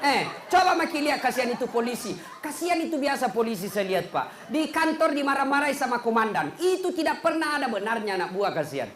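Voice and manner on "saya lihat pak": normal talking voice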